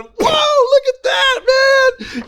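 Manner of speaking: put-on high excited whoa, boys gawking. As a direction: shout it high like amazed boys. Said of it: "We're going".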